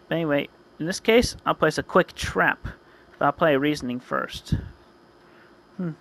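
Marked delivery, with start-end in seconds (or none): none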